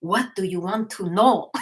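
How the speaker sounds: put-on accent, each word set apart; measured question in an imitated accent; Metatron's accent. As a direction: imitate an accented spirit teacher asking. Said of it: "That's how he talks".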